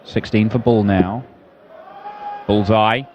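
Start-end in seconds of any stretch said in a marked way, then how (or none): none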